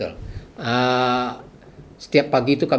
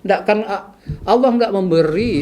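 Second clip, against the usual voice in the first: higher, insistent preaching at someone; retold ranting; 8 semitones higher than usual. Patient lecturing sick man about God's test, ranting. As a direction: keep lecturing him, pitched up. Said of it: "Sampai saya ngoceh-ngoceh gitu".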